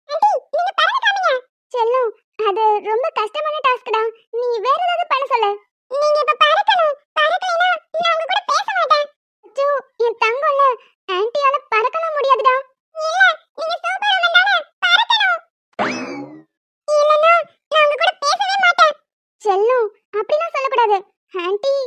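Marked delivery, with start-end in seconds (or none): 0.1-1.3 put-on high, pushy little girl voice; demanding child. Little girl demanding the aunt fly. 5.9-9.1 put-on stubborn child. Little girl threatening the aunt, sulky. 13.0-18.9 stamp your foot and sulk; stubborn child. Acted. Little girl sulking at the aunt, pushy.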